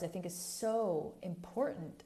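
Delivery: usual speaking voice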